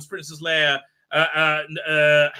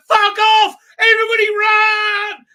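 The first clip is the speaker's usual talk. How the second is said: very high, loud shriek; mock panicked scream; 12 dB louder, slower than usual. Heroine warning everyone of the detonator, high screaming mock panic.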